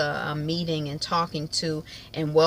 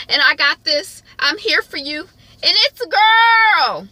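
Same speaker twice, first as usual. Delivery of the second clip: rising excitement to a long triumphant holler; urgent encouragement; 9 semitones higher, 7 dB louder than usual. Retold or imitated. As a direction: act out a husband coaching a birth, ending in a jubilant yell. Husband urging wife through childbirth.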